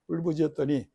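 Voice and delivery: ordinary talk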